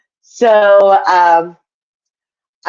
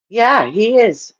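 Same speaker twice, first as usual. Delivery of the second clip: normal talking voice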